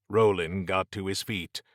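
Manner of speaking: usual speaking voice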